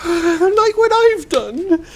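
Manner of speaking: slow high sigh-laden wail; a fawn ashamed of what he did; sighing confession. Acted. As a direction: breathe it out in despair.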